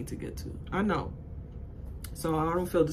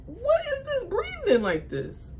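mock panicked gasp. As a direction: squeal it like you can't breathe. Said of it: "I said".

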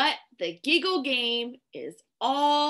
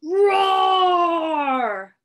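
loud, long drawn-out roar; lion roar; 2 semitones higher, 6 dB louder than usual. Shown as a demonstration. Reader shows children how a lion sounds, loud roar. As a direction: stretch the word into a big roar.

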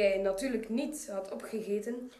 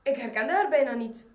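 usual speaking voice

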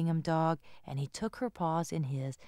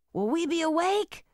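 put-on higher child voice; little boy; 11 semitones higher, 4 dB louder than usual. Owen asking Grandma at dinner, eager. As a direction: ask eagerly. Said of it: "Owen asked".

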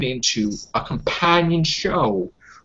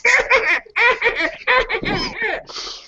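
laughing hard. Real, not acted. Laughs at own mockery.

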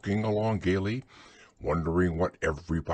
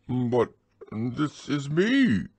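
slow, a touch higher in mild surprise; surprised; 7 semitones higher, 4 dB louder, slower than usual. A narrator's reading. Pooh to the voice in the hole, mildly surprised. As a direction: sound taken aback. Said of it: "very much surprised".

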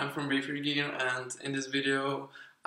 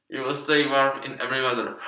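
muffled through thick fabric; speaking behind a balaclava; through a face mask; 5 dB louder than usual. Real, not acted. Seen 4: Thick knit balaclava and neck warmer cover his mouth throughout.

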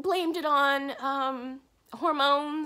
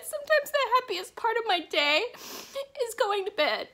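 real tears, voice pitched much higher and breaking into sobs; talking through real crying, higher and louder; 10 semitones higher, 5 dB louder than usual. Real, not acted. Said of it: "I really didn't think I was gonna cry this much".

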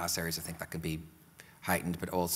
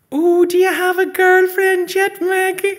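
much higher, louder teasing aunt voice; beaming aunt; 15 dB louder, slower than usual. Acted. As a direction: gush at the boy all smiles. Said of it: "all smiles".

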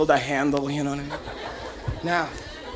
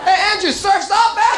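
calling out; 13 semitones higher, 6 dB louder than usual. Retold or imitated. Friends calling out to him.

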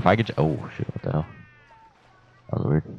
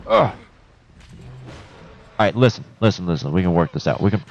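ordinary talk, nothing marked

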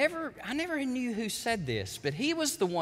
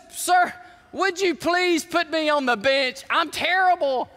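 loud, high-pitched pleading, acted out; mock despair, pushed high and loud; imitated tearful plea; 9 semitones higher, 7 dB louder, slower than usual. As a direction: plead like a distraught rookie, hammed up. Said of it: "and he's weeping, he's crying".

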